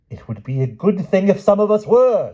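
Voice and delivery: wife screaming back at the king. Acted. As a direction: shriek it at her. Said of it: "screamed the King".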